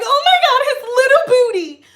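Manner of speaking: very high, loud squeal; hammed-up emotional cooing; mock gushing. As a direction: squeal over something tiny and adorable. Mimics gushing over an ant.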